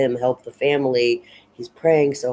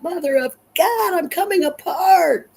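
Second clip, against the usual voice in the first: very high, strained wail of despair; falling apart, voice cracking upward; breaking down; 5 dB louder than usual. Retold or imitated. George pulls his hair out in a breakdown, high anguished wail. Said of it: "another breakdown from Pride".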